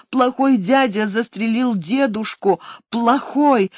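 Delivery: put-on high childlike voice, shaken; upset little girl. A narrator's reading. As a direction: speak like a scared small child. Frightened child tells mother.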